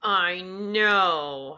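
words pushed through clenched teeth; through gritted teeth. Real, not acted. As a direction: jaw clenched tight while speaking. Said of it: "I'm gritting my teeth right now".

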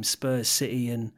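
ordinary delivery

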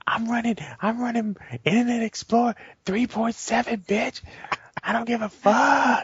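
high, hammed-up defiant holdout; defiant old-software user. Co-host mocking someone clinging to ancient software, defiant high voice.

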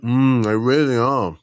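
eating while talking; mouth full. Real, not acted. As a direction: talk through a mouthful of biscuit. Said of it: "talking with my mouth full".

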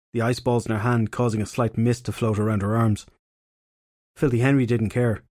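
usual speaking voice

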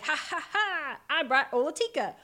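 mock villain laugh; gleeful villain. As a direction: gloat like a cartoon villain. Villain herald voiced gleefully.

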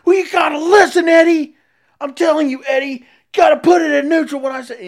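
high, loud furious shout; screaming boss. Retold or imitated. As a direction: yell the lecture at Eddie. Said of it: "screaming at him".

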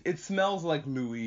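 normal talking voice

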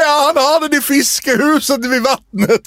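high, drawn-out imitation of a queen; queen's reply. Comedian acting out the queen's answer from the audience, high mimicry. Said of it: "säger hon".

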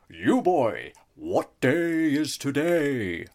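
put-on loud, slow old miser's call; hammed-up Ebenezer Scrooge calling to a boy. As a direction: bellow it like Scrooge at the window. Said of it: "while I was in Ebenezer Scrooge mode".

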